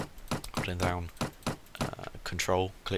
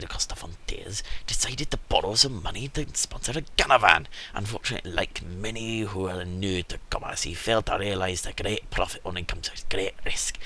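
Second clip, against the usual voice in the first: lightly voiced Scottish-ish merchant; a hint of a Scottish merchant; put-on merchant accent. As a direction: read the merchant in a made-up accent. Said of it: "He's now Scottish".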